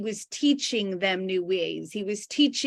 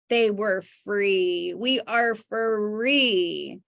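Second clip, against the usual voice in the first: slow emphatic repetition; much slower than usual. Real, not acted.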